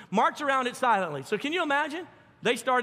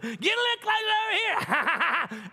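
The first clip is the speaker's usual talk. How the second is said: high, slow jeer through laughter; laughing taunt; 9 semitones higher, slower than usual. Retold or imitated. Jericho guards luring marchers closer to dump poop, slow laughing taunt. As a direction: lure them closer while laughing at them. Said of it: "And you know they're laughing".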